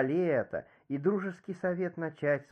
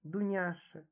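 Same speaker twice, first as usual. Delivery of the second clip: soft, higher-pitched gentle call; softened pity; 4 semitones higher, 8 dB quieter than usual. A narrator's reading. Revived Lizetta addresses her maid Dunyasha, soft and pitying. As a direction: call the maid softly and tenderly. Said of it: "Столь жалостная речь Лизетту воскресила".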